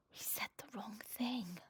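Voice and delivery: soft, slow worried whisper; anxious whisper. Acted. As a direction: whisper it to yourself in dismay. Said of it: "thought Piglet anxiously".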